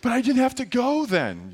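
high mock-kid voice; high, plaintive pretend child; protesting child. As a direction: imitate a little boy pleading his case. Son protests back to dad.

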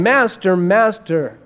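loud, urgent cry for help; freaking out. Retold or imitated. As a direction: cry out to wake the master. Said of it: "they came to him freaking out".

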